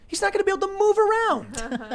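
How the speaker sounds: very high, squeaky comic pitch. Real, not acted. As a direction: jump way up into falsetto.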